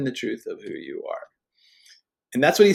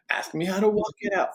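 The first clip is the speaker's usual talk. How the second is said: pitched-up repeat; 8 semitones higher than usual. Shown as a demonstration. Preacher urging the men again, higher and eager. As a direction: ask me how to walk it out much higher. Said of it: "Now ask me how to walk it out".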